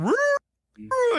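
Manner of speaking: very high dog howl; Scooby howl. Retold or imitated. Scooby cheering in the background, high howling woo. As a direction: howl like an excited cartoon dog. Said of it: "Scooby in the background going".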